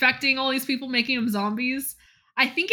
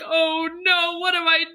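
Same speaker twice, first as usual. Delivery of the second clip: higher, rising alarm; a hammed-up gasp of horror; mock panic; 6 semitones higher, 4 dB louder than usual. Charlotte panics.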